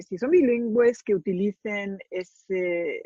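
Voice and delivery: natural Castilian Spanish, warm; Spanish from Spain. Real, not acted. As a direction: speak it in your native Spanish. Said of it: "say something in your fabulous Spanish accent".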